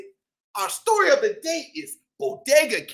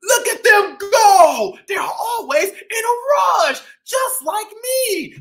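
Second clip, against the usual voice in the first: lively shopkeeper cat telling his story; boastful bodega-boss cat, upbeat; cat narrator Chip; 9 semitones higher, 6 dB louder, slower than usual. Acted.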